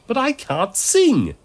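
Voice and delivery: high, loud, drawn-out whine; dismayed, moaning excuse; moaning protest. A narrator's reading. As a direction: whine it slowly, stretching the words. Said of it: "Alice moaned".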